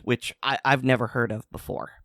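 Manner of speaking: ordinary talk, nothing marked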